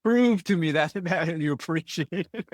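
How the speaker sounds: said through a laugh; amused, laughing as you speak. Real, not acted. Host laughing while speaking.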